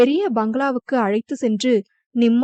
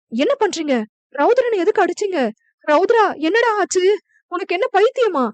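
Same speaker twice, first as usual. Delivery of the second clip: a mother crying over her hurt son; distraught mother's cry; crying woman pleading; 7 semitones higher than usual. A narrator's reading. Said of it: "என அழுது கொண்டே".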